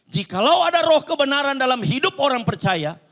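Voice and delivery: loud, high-pitched sermon delivery; full-force preaching. Real, not acted. Preacher urging believers back to Jesus' teaching, full-force preaching. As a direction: preach it out at the top of your voice.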